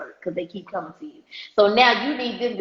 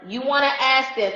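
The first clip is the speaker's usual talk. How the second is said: normal talking voice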